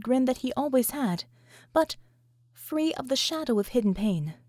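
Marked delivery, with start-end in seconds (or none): none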